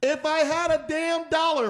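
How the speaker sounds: laughing. Real, not acted. Laughing outburst at advice.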